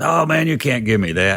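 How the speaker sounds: imitated high-pitched protest; amazed gratitude. Rock star is handed the amp, amazed.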